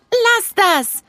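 put-on stern child telling a girl off. A narrator's reading. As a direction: Nele sternly tells the sand-throwing girl to stop. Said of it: "sagte Nele streng".

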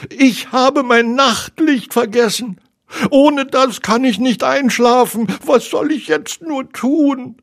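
high, loud piglet panic; acted high-pitched distress; despairing. A narrator's reading. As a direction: wail helplessly in a small creature's voice. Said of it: "rief er verzweifelt".

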